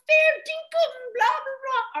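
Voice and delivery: a quick high Australian band impression; mock Australian accent. Guest mimics the Australian band members in a hammed-up Australian accent. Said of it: "I can't do an Australian accent".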